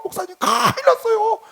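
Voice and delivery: high, loud and frantic, like breaking bad news; flustered panic. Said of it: "전도사가 그냥 난리가 났어요".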